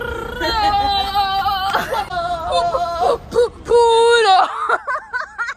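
screaming laugh. Real, not acted. Screaming laugh after hype.

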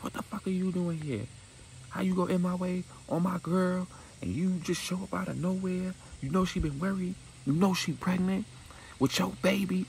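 high-pitched scolding woman's voice, going off; angry girlfriend voice. Retold or imitated. Destiny going off on Charlie.

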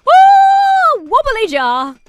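loud whooping shout; excited. Real, not acted. Player thrilled at spotting a wobbly jar, excited. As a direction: whoop high, then call it out excitedly. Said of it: "Sorry, I got excited".

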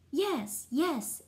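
two punched, high character toots; tooting rudely. A narrator's reading. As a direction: toot it out like rude horns. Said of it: "the others tooted rudely".

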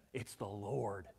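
low, soft, awed quote; hushed recognition. Retold or imitated. John quietly telling the others it is Jesus, hushed. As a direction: drop right down for this quote.

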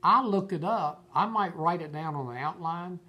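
ordinary delivery

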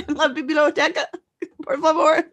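mock Spanish accent. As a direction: ham up beginner's Spanish. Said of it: "she fakes an accent around him".

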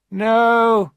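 put-on high, loud, harsh rich old woman; hard old woman. A narrator's reading. Rich old mother mocking her daughter's suitor, high and loud. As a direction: sneer down at the poor suitor.

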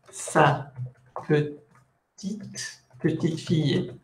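soft "sa ... petite", pause, then falling "petite fille"; first "petite" rising and separate, then joined "petite fille"; tone contrast. Shown as a demonstration. Teacher building "little granddaughter", soft rising "petite", pause, "petite fille". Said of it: "aquí se juega en ... del tono".

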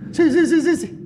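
high, rapid, nervous yeses; cowardly Acab. Acted. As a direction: cower and agree at once. Acab voiced, cowardly agreeing. (Acab cobarde obedece a su reina, voz aguda y nerviosa)

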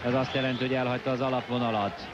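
ordinary talk

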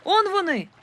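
high loud cry of discovery; shout. A narrator's reading. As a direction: call out, spotting them. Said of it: "крикнув хтось".

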